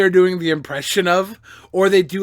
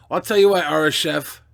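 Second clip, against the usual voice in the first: ordinary talk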